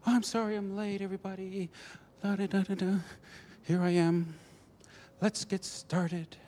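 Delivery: high, airy, sing-song cheeriness; mock happy. Late woman bustling into the meeting, mock happy. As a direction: breeze in, imitating someone all smiles. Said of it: "she's all smiles and she's happy".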